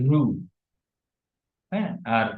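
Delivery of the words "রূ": রু with a sustained long u; stretched vowel on রু. Shown as a demonstration. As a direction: hold রু out as "ruu". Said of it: "রর সাথে দীর্ঘ".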